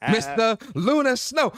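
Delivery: high, loud announcer hype, dragging out the titles; bigging up your bro like a ring announcer; hyped arena-style introduction of his co-host; hype-man introduction. Acted.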